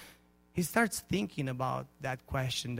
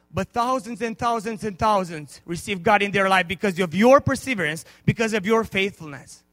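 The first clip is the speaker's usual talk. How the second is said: louder triumphant proclamation; preaching at full force; 4 semitones higher, 8 dB louder than usual. Acted. Evangelist's reply preached.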